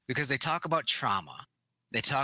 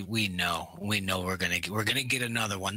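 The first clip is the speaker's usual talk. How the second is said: normal talking voice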